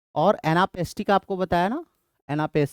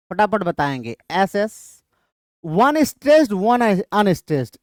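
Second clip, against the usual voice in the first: lively quiz options; 3 semitones higher, slower than usual. Shown as a demonstration. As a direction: call out each option with energy. Reads options with codes.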